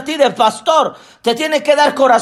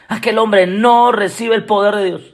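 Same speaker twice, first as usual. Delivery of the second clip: usual speaking voice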